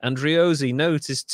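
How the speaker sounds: normal talking voice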